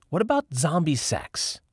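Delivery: Lauren's voice. A narrator's reading. Lauren breaks silence.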